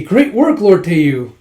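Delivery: high, loud praise; hearty greeting. Acted. A lord hails Teiyu loudly. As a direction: boom out the compliment.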